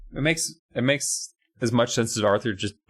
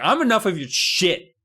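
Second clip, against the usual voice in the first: mock angry dad; 9 semitones higher, 4 dB louder than usual. Dad snapping at Jordan.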